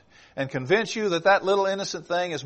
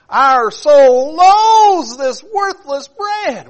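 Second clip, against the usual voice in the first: high, shouted, very slow wail; crying out; 11 semitones higher, 13 dB louder, much slower than usual. Acted. Israelites rejecting the manna, high crying shout. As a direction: wail it like a furious starving crowd. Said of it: "God sent the manna".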